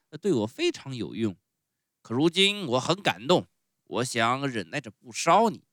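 loud, theatrical ogre voice; argue, then bellow for the guards; blustering showman. Acted. Fire-eater spares Pinocchio, calls guards, loud bluster.

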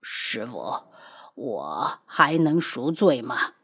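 very low, soft and slow; stunned disciple asking his master, slow and hushed; dazed. A narrator's reading. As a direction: numb with shock. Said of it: "呆滞着道".

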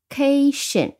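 "cation" stretched slowly with CA stressed; main stress at back. Shown as a demonstration. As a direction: lean long and hard on the CA of "cation". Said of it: "我们重音放在后面".

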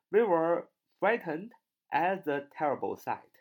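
lifted second read of the example. Shown as a demonstration. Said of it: "比如说".

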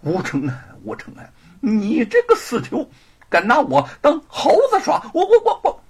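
stammering, high-pitched outburst; enraged. Acted. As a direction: sputter furiously at being made a fool. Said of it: "恼怒地说".

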